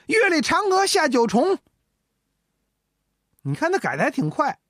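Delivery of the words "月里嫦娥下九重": recited verse. Acted. Ji quickly revises his verse.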